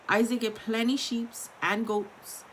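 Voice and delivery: plain reading voice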